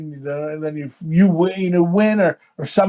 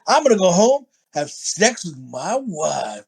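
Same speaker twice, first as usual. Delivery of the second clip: cool badass. Hero's triumphant exit line, cool badass. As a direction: announce it coolly, drawing out the last words. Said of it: "The way he says it, he's so fucking cool".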